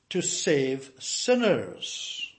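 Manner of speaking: to save sinners, each word drawn out slowly; slow emphasis. Shown as a demonstration. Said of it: "this is the second emphasis".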